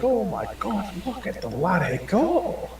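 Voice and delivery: high, slow gleeful cheer; delighted dwarf. Retold or imitated. Dwarf in the tavern cheering the elf's killing spree, high slow glee. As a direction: cheer it like a thrilled onlooker.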